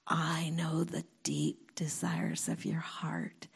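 soft, low whisper as God speaking; hushed intimate voice; tender whisper. Retold or imitated. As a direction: breathe it gently as a secret. Voicing God's words to her.

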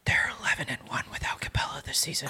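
quick hushed whisper. Real, not acted. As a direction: whisper it back, a bit hurried. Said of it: "Why are we whispering?".

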